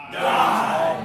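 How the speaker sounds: loud rasping shout. Shown as a demonstration. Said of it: "you'll shout".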